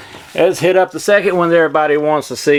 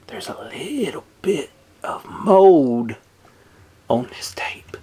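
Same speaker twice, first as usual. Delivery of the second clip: dramatic hushed whisper; 4 dB quieter, slower than usual. Pretend. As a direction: breathe it like a secret. Whispering about mold.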